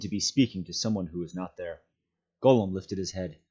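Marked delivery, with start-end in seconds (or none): none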